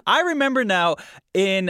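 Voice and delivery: normal talking voice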